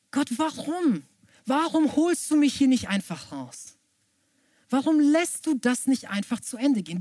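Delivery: high-pitched, urgent appeal to God; pleading. Acted. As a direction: plead upward, desperate for it to end. Acted desperate questioning of God. (flehend, mit hoher Stimme)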